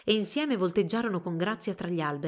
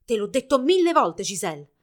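raised, loud maternal scolding; angry scolding; 3 semitones higher, 7 dB louder than usual. A narrator's reading. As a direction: shout a reproach at your daughter. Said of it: "gridò la madre".

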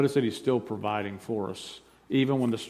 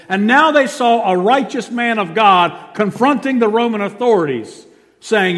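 high, loud proclamation; full-force preaching; 10 semitones higher, 12 dB louder than usual. Real, not acted. Preacher proclaiming a righteous man confronting authorities, full-force preaching.

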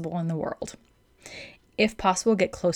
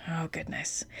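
whisper; 3 semitones lower, 11 dB quieter than usual. Real, not acted. Aside about freedom-loving dogs.

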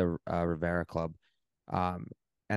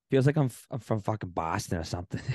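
usual speaking voice

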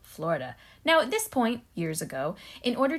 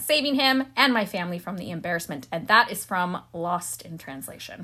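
normal talking voice

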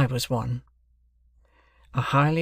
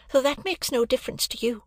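put-on lightly raised girl's voice; higher voice, a little pointed; young woman voice; 12 semitones higher than usual. A narrator's reading. Biddy voiced in dialogue.

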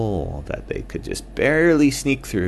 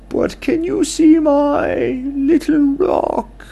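high, loud owl character; squeaky owl friend; 8 dB louder, slower than usual. Acted.

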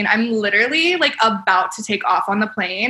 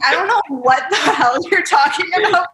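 higher, laughing through the words; laughing disbelief; 5 semitones higher than usual. Retold or imitated. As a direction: crack up mid-sentence in mock outrage. Retold reaction to a made-up shade.

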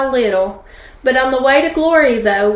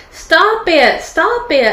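higher, urgent acted cry; cried-out plea; 5 semitones higher, 3 dB louder, slower than usual. Teapot gagging on fumes, cried-out plea. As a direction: cry out for it to stop. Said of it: "I cried".

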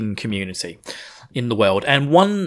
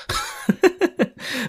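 breathy high giggle; 4 dB quieter than usual. Real, not acted. Laugh after mouthful remark.

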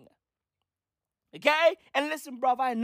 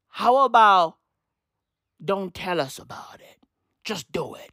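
hushed advice; 6 semitones lower than usual. Acted. Comedian advising an oversharing father, hushed and low. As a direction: say it low under the breath. First, restrained advice to the poster.

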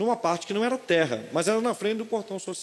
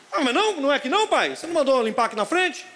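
put-on child's voice; 9 semitones higher, 4 dB louder than usual. Voz do filho confuso.

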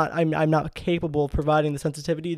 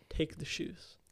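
cold, softer, breathy order; 12 dB quieter than usual. Retold or imitated. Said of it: "I said".

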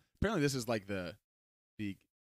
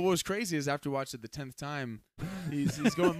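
ordinary talk, nothing marked

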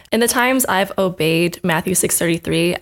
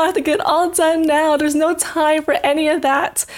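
a stressed student, much higher and frantic; frantic refusal; 9 semitones higher than usual. Retold or imitated. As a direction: insist there's no time, panicking. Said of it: "And I was like".

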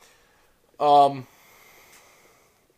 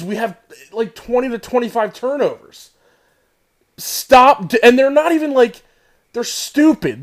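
frustrated rant; 4 semitones higher, 8 dB louder than usual. Real, not acted. Ranting about the team's turnovers.

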